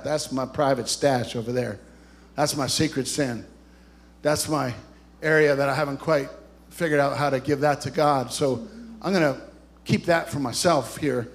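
low, private aside to oneself; guarded confession. Acted. Voicing someone guarding secret sin.

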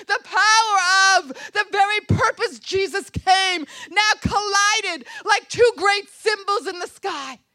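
high, loud, fervent preaching; rousing preaching. Real, not acted. Preacher hails power and light swallowing darkness, rousing loud delivery. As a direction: fire up the congregation with each repeated phrase.

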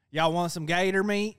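put-on Southern accent. Host imitates a dad offering food, in a loud Southern accent. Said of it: "a brown guy with a southern accent".

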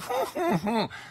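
sudden high-pitched laugh; real high laugh breaking out; high burst of laughter. Real, not acted. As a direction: crack up at your own joke. Laugh after child imitation.

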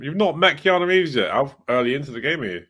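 can't believe he hasn't met him; incredulous. Real, not acted. Friend shocked he hasn't met Keanu, incredulous.

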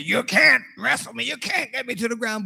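high, boastful dad taunt; mock-defiant boasting; playful taunt. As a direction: crow it at the kids trying to topple you. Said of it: "I was standing and said".

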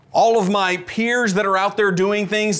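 ordinary delivery

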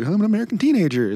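high-pitched mock teenager voice; hammed-up high teen voice; American teenager onstage. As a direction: pipe it up high, announcing yourself. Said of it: "just be like".

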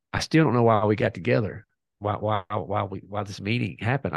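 usual speaking voice

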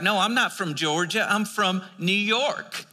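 put-on Southern accent, drawing out New York. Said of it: "I can do a better Southern accent".

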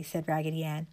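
ordinary delivery, nothing marked

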